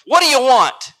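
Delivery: loud, high, snapped demand; irritated bark. A narrator's reading. Cottage woman to a stranger at her door, barking. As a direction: bark it at an unwelcome caller. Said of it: "opened the door a few inches and barked".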